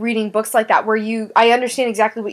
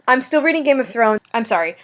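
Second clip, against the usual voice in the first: ordinary delivery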